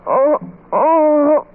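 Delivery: broken sobs fading to a breath; weep, then run out of voice; sobbing. Acted. Friend discovering Edna dead, sobbing.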